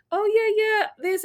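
mocking friends. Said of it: "my friends are like".

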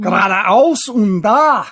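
hammed-up German accent; commanding German. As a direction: give mock orders in German. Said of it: "well, speak German".

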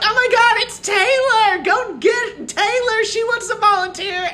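high, loud excited squeal; squealing party girls. Retold or imitated. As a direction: shriek like a bachelorette party egging on a friend. Bachelorette girls shouting out.

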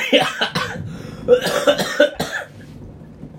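fit of coughing after choking. Real, not acted. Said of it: "むせた".

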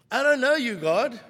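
loud defiant denial. Acted. Peter crying out his denial to God, loud.

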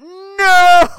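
high, loud drawn-out no; anguished "No!". Retold or imitated. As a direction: scream the no in horror. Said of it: "I went".

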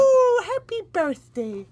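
slow, high bad Welsh accent. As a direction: attempt a Welsh lilt. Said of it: "I can't do a Welsh accent".

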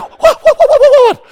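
high, loud rapid-fire whats; startled and rushing over; alarmed. Retold or imitated. Husband answering his wife's scream: alarmed shout.

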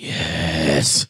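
low, drawn-out "yes"; mock deep satisfaction; acted savouring approval. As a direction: relish it in a deep voice. Acted delighted reaction to the video.

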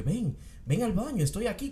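a hint of a clown luring a kid to the bathroom; mild clown luring voice; Pennywise voice. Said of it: "la voz en el background de Pennywise".

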